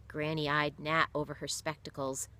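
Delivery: usual speaking voice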